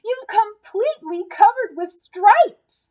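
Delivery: high, loud horrified exclamation; a frantic mother, still crying out; alarmed cry. Acted. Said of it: "she cried".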